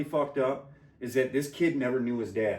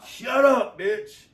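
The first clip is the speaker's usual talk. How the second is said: high, loud, slow barked orders; hammed-up angry shouting; mock yell; 11 semitones higher, 7 dB louder, much slower than usual. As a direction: yell down at her while carrying her. Retold restraining her.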